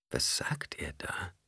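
hushed, slow whisper to oneself; inner question. A narrator's reading. Alia wonders at the preacher's words, whispering. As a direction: whisper it as a private thought. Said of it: "fragte sich Alia".

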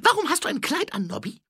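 high, loud, quick character voice; nosy petty thief. A narrator's reading. Thief asking watchman about his dress, high and loud. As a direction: ask it in a high-pitched shout.

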